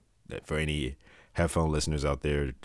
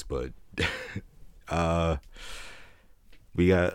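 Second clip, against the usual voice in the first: ordinary delivery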